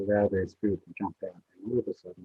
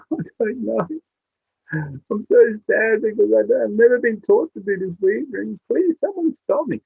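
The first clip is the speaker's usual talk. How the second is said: distressed weaver bird; 11 semitones higher, 6 dB louder than usual. Acted. Bird voiced pleading in story.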